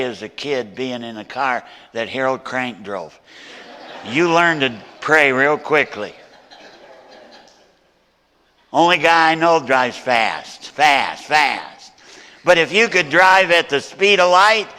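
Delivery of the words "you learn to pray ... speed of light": loud, ringing pulpit preaching; full-force preaching. Acted. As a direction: preach it out to the whole room. Preaching with humour to congregation.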